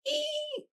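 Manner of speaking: very high squeal; pig squeal. A narrator's reading. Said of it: "squealed the pig".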